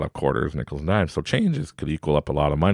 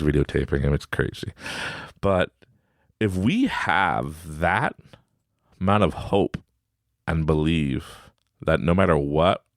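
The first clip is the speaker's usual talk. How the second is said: usual speaking voice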